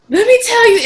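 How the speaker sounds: high, loud mock venting to a friend; going off. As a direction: launch loudly into a dramatic rant. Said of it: "just go off".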